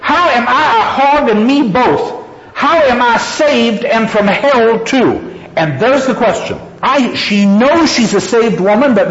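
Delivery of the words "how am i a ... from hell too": high, contained fury read aloud; concentrated fury. A narrator's reading. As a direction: throw the furious question upward. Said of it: "in its concentrated fury".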